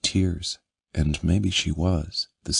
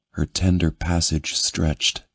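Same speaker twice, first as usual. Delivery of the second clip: plain reading voice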